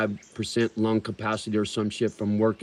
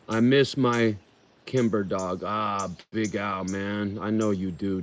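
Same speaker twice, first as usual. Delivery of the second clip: normal talking voice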